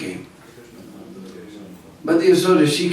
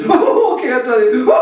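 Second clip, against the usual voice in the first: high voice through laughter; high laughing boy voice; giggly teasing boys; 9 semitones higher, 4 dB louder than usual. Acted. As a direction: give in while giggling. Boys giving in.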